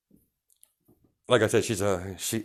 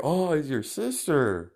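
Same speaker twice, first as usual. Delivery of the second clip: sneering bully; 5 semitones higher than usual. Acted. Bully taunting the sister.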